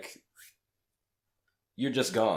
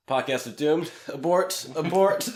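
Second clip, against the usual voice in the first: raised, loud emergency call climbing higher with each word; mock alarm; 8 semitones higher, 9 dB louder than usual. Podcaster jokingly calls off the show after giving away location, mock alarm. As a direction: playfully sound the alarm, louder and higher.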